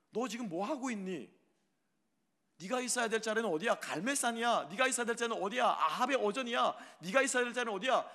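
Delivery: loud, rising, urgent challenge; God's challenge thundered from the pulpit; preaching at full force. Acted. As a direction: press the question home like a preacher.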